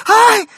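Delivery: very high, loud startled cry; lady's shriek. A narrator's reading. Lady as her dog squeals, high shriek. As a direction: shriek out loud at the top of the range. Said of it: "закричала дама".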